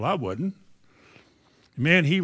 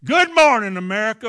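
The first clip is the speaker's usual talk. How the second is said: much higher and louder on-air greeting; booming greeting; 6 semitones higher, 6 dB louder than usual. Retold or imitated. Stuttering preacher forcing out a loud radio greeting. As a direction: throw it out big like a radio host. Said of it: "I was trying to say".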